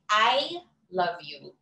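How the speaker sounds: slow, "I" held and stressed. Shown as a demonstration. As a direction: put the weight on "I". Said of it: "very different if you say".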